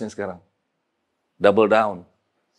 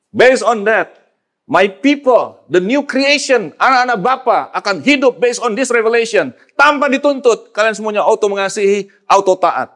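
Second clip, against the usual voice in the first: higher, louder fervent preaching; preaching at full force; 10 semitones higher, 6 dB louder than usual. Acted. Preacher rousing the congregation, fervent full-force preaching. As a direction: build to a fiery climax.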